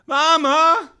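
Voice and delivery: high, loud call for mother; shouting. A narrator's reading. Sick child calls to mother who doesn't hear, shouting. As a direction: call out at full voice on a high held note. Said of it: "I was sure I was shouting".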